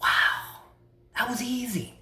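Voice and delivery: hushed, breath-led relief; soft amazement after letting the breath go; acted quiet relief; relieved.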